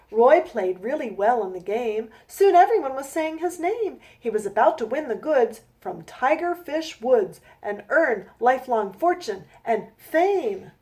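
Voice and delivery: slow, rhythmic rhyming read-aloud; storybook reading. A narrator's reading. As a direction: read each rhyme out slowly to a child. Reads her rhyming picture book.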